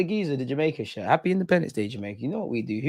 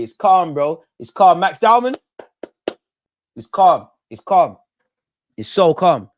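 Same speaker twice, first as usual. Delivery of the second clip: loud reassurance; 3 semitones higher, 8 dB louder, slower than usual. Real, not acted. Reassuring team after missed penalty.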